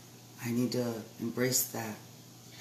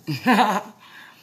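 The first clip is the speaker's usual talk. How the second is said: muffled laugh; 8 semitones higher, 11 dB louder than usual. Real, not acted. Laugh behind the mask.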